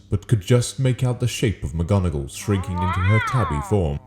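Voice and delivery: ordinary delivery, nothing marked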